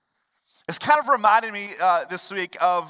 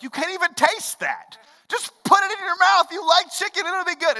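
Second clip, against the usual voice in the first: put-on high, loud pleading with a picky child; exasperated pleading; 10 semitones higher, 4 dB louder than usual. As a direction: beg her to just take one bite. Parent pleading with child over chicken.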